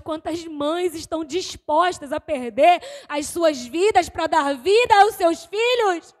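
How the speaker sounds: usual speaking voice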